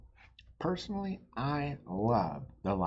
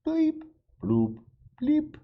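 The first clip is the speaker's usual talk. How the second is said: much higher, louder beeping robot; 8 dB louder, slower than usual. Acted. Robot Pete talking at the robot dance party, beeping robot voice. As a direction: chirp it out like a toy robot. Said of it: "When Robot Pete speaks, he says".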